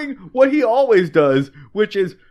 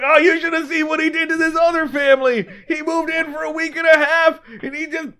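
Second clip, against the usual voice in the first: high, fast gossipy sidekick; radio sidekick; 6 semitones higher, faster than usual. Acted. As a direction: spill the dirt in a raised, excited voice. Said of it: "this Howard Stern side character is just like".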